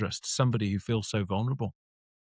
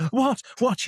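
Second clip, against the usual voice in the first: panicked what; 11 semitones higher than usual. Retold or imitated. Panicked reply to therapist.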